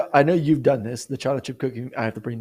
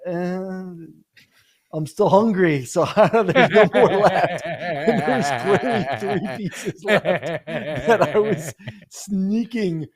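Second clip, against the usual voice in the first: laughing; 5 semitones higher, much slower than usual. Retold or imitated. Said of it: "I'm like".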